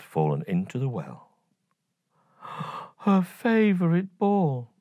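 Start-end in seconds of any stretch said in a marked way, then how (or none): 2.4-3.0 quick unvoiced gasp of dismay; a sharp little intake of breath; hushed gasp of shock; soft gasp. A narrator's reading. Narrator gasps at the loss.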